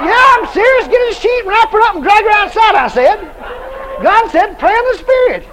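high, loud, laughing insistence; emphatic insistence. Retold or imitated. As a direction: push it over the top, laughing. Insists they drag her outside.

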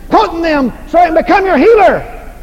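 ordinary talk, nothing marked

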